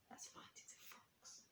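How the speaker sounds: hushed whisper. Real, not acted. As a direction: whisper it so the fox won't hear. Whispered aside to listeners.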